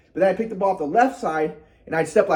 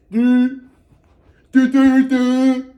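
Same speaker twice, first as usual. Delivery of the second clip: loud, higher "do do do" sound effect; vocal motion noise; 5 semitones higher, 6 dB louder, much slower than usual. Retold or imitated. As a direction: punch out each "do" like a clumsy shot. Imitating his wrong shooting motion.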